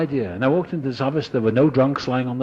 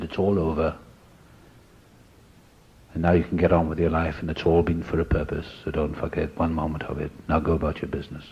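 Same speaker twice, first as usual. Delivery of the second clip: a lightly lowered, steady voice from above; hint of a commanding inner voice; big powerful voice; 5 semitones lower than usual. The voice he heard tells him his drinking is over. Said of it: "this big, powerful voice".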